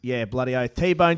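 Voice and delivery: normal talking voice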